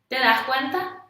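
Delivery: usual speaking voice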